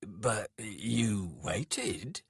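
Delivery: very slow, measured reply; unhurried, composed voice; calm. A narrator's reading. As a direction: point it out calmly, word by word. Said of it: "said Skalg calmly".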